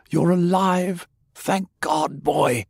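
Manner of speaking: high, relieved exclamation; the grandfather's joyful cry; high, gushing relief; overjoyed. Acted.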